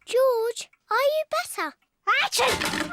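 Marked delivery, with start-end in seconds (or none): 2.2-2.9 sneeze. Acted. George still has cold.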